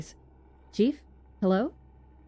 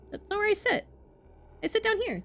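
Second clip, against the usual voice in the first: much higher, fussy character voice; high squeaky voice; 13 semitones higher than usual. Acted. Streamer re-reads the line as a character, far higher. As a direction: pitch it way up like a prim scholar. Voicing game dialogue, second take.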